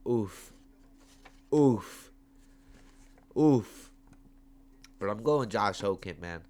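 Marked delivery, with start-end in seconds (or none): 1.5-3.9 loud wincing oof; pained oof. Real, not acted. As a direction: groan in sympathy. Wincing exclamations.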